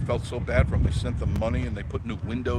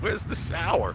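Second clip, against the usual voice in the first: puzzled newcomer; 7 semitones higher than usual. Retold or imitated. Voicing puzzled newcomer in story.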